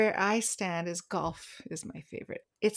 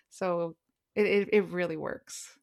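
ordinary talk, nothing marked

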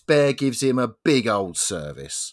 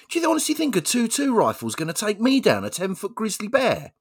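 higher-pitched bear voice, scornful; 9 semitones higher than usual. Acted. Bear scolds the hunter, a higher scornful voice. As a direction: sneer at the little handgun as the bear.

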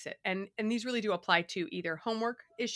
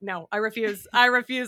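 firm refusal; 3 semitones higher, 11 dB louder than usual. Retold or imitated. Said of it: "I'm like".

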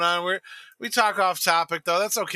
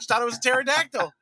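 ordinary delivery

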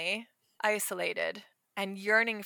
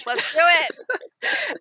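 hyped up; 9 semitones higher, 6 dB louder, much slower than usual. Real, not acted. Hyping up the next turn.

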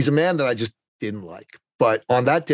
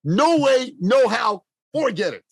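high, insistent no way; pitched up, refusing the idea outright; emphatic; 11 semitones higher than usual. Real, not acted. Telling the host it was impossible at first, high and emphatic.

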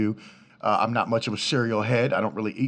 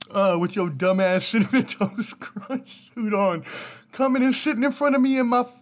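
laughing rant; 10 semitones higher than usual. Real, not acted. Fan ranting at the mascot, laughing hard. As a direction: keep ranting while cracking up.

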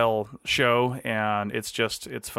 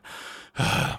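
heavy breathing. Shown as a demonstration. Podcaster showing the wheezing he edits out, heavy breath. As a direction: let out a heavy, winded breath. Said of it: "most of my wheezing and".

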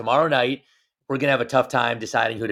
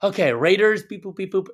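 high playful beep boops; playful robot beeps; 7 semitones higher, 3 dB louder than usual. Pretend.

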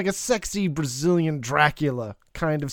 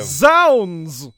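loud theatrical oath; hammy stage actor; 7 dB louder than usual. Pretend. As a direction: bellow it like a ham actor. Mock Shakespeare reaction.

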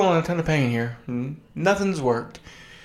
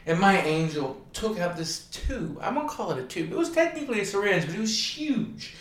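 ordinary talk, nothing marked

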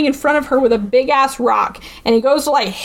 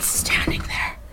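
hushed suspense; 4 semitones lower, 4 dB quieter than usual. Real, not acted. Teller revealing Hart standing across the street, hushed. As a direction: drop to a whisper for the creepy reveal.

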